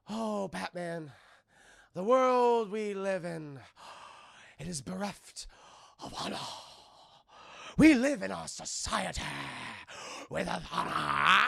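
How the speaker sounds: slow, theatrical Joker drawl; mock Joker monologue. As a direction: ham up the Joker's speech.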